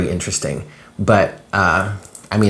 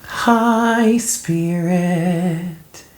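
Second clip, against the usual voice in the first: sing-song greeting; 9 semitones higher, much slower than usual. Real, not acted. Greeting spirit before reading.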